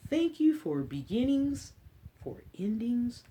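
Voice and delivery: slow storybook read-aloud; reading to children. Acted. Librarian reading an Earth book to kids, storybook voice. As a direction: read it gently to a class of little ones.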